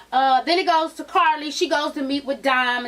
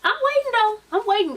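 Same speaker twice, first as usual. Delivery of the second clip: high sweet mock calm; 12 semitones higher than usual. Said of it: "she was real calm".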